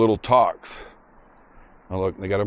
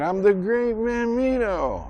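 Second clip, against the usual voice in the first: high, slow, muffled boast; drawn-out high-pitched mumbled brag; mouth-full movie kid; 12 semitones higher, slower than usual. Retold or imitated. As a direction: imitate a boy boasting round a mouthful. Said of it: "takes it out of his mouth".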